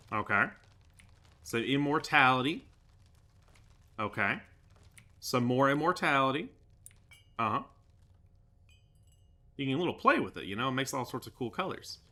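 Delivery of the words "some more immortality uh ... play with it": ordinary talk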